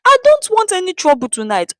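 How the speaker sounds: woman explaining to the thugs, tearful; crying woman. A narrator's reading. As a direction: plead through tears. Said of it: "the woman cried as she explained".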